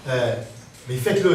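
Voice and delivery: ordinary talk, nothing marked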